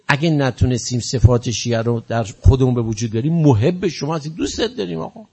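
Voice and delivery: pleading prayer to the beloved imam, rising toward the end; preacher leading the mourners in prayer to Imam Hadi; devotional supplication. Acted. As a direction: implore lovingly, building in fervour.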